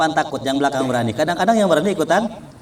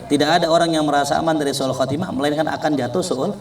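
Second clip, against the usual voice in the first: normal talking voice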